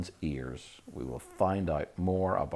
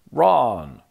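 sharp, much louder, higher shout; loud call; 8 semitones higher, 14 dB louder than usual. A narrator's reading. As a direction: call out to stop them. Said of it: "a loud voice".

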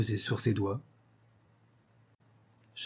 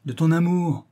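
higher, louder passionate outburst; comically vehement; 5 semitones higher, 5 dB louder than usual. A narrator's reading. Comedienne cries out to her doubting lover, comically vehement. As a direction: exclaim it with overdone vehemence. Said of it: "avec une véhémence ... tout à fait drôle".

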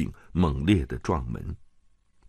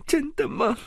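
tearful question. Acted. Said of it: "莱莎边哭边问".

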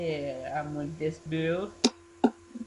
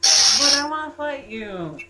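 loud, high, drawn-out whine; whiny shout; 10 semitones higher, 10 dB louder, slower than usual. Acted. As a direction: whine it loudly like a kid. Teasing friend who refuses fight.

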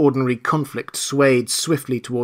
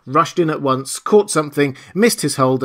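plain reading voice

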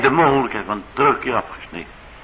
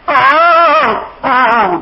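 1 anguished oh; 13 semitones higher, 7 dB louder than usual. Real, not acted. Preacher's anguished outcry.

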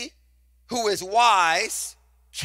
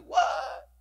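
surprised what; 7 semitones higher, 4 dB quieter than usual. Retold or imitated. Shocked reaction to cursing.

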